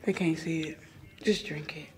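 low whispered aside; whispering. Real, not acted. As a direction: whisper it to her. Aside to co-host about shot.

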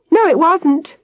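put-on high-pitched child's protest; crying out. A narrator's reading. Peter contradicting the grown-up, crying out high. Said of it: "Peter cried".

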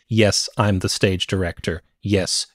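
usual speaking voice

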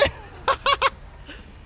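sudden squeaky giggle; real delighted laugh; high breathy laugh. Real, not acted. Laughs at stolen bus.

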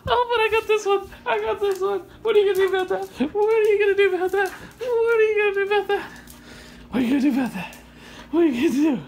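high-pitched, playful taunting; excited teasing. Real, not acted. Owner holding the toy from the dog, high teasing. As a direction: tease a pet in a high excited voice.

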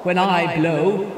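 humbled wind. Acted. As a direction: admit defeat with a sigh.

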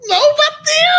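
high-pitched, loud mock wail; esposa chapada em negação. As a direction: imitate a wife shrieking a comic denial. Wife high, acting stoned denial. (esposa negando num grito agudo imitado)

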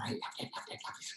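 soft, low devoted whisper; breathy whisper. Real, not acted. Confessing love of the volcano, soft whisper.

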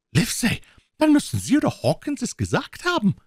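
high-pitched, accusing outcry; flustered squire, voice pitched far up; exclaiming squire. A narrator's reading. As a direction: blame them in an excited high cry. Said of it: "rief der Squire".

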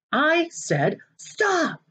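high and very slow, each word set down; last warning before losing it; final warning. Acted. Maniac's final warning to boys.

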